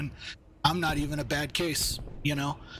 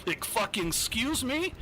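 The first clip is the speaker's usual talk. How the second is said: higher, incredulous excuse me; indignant retort; 8 semitones higher than usual. Retold or imitated. To the VA director who read his file, indignant. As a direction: throw it back at him, offended. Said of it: "And I'm like".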